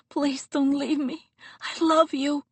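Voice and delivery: high, pleading and tender; desperate plea. Acted. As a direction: beg him not to leave. Anya begs her lover.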